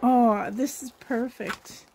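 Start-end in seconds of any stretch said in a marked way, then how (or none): none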